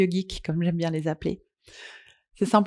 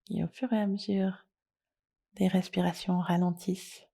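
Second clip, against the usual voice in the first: soft, unhurried yoga nidra guidance with long pauses; guided meditation; 5 dB quieter than usual. Acted. Leads a guided relaxation.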